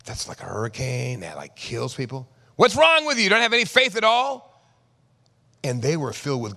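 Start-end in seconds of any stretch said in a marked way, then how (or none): 0.0-2.3 low, subdued reply; disciples' thoughts. Acted. Disciples thinking after being scolded, quiet protest. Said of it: "They're thinking". 2.5-4.6 loud, very high outburst; exasperated scolding. Acted. Jesus to disciples without faith, loud exasperated scolding.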